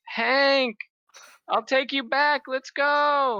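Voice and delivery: high, loud woman's voice; Vivian voice. Acted. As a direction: call him in a high bright voice. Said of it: "she goes".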